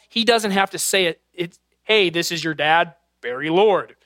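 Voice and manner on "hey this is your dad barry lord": lightly voiced dad. Dad calling his son and saying who it is, lightly voiced. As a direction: slightly lower dad on the phone. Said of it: "When my dad calls".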